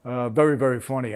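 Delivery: ordinary talk, nothing marked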